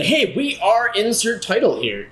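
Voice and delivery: slow, high, hammed-up host greeting; sing-song cheesy show opener; mock podcast intro. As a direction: stretch out the fake host hello. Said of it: "It's not like we say".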